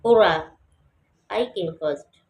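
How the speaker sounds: Hurrah loud, first falling; falling exclamation. Shown as a demonstration. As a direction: cheer then drop on first. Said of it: "ये भी falling है".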